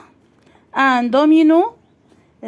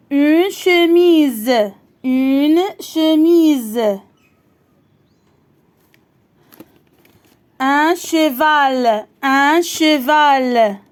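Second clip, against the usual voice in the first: very slow, deliberate word-by-word reading; teacher reading picture words slowly to pupils; slow dictation reading; much slower than usual. Shown as a demonstration. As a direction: read each word slowly so a child can follow.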